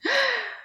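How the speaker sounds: sharp high frightened gasp; quick shocked intake of breath; scared gasp. Shown as a demonstration. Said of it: "We have angry".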